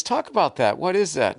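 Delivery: mock talk show host. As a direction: play the eager TV host asking about the book.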